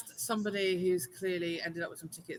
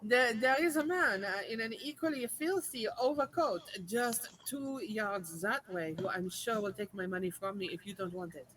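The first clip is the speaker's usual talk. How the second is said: put-on French accent. Customer pointing tout to a rival seller, French accent. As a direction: threaten to walk away in French-accented English. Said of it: "do it in your French accent".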